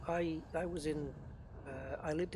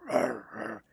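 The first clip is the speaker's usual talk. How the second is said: imitated heavy dog bark; 5 semitones lower, 9 dB louder than usual. Imitating Merlin the dog's heavy bark for viewers, low loud woofs. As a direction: lower and much louder, a deep woofing bark. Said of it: "heavy, like".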